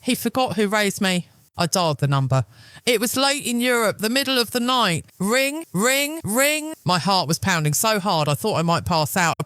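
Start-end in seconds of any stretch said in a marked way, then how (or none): 5.2-6.7 imitated phone ring.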